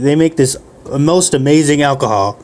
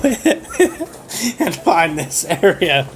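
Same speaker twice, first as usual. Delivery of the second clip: slow, breaking into laughter mid-line; can't hold the line for giggling, slow; laughing through it; 4 semitones higher, much slower than usual. Retold or imitated. The girl urges finding the orcs, laughing slowly. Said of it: "And she's like".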